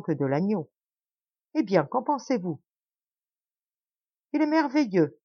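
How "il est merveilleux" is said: much higher, eager praise; feigned delight. A narrator's reading. Schacabac praises the host's stew with feigned delight. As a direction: gush over the imaginary stew. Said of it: "répondit Schacabac".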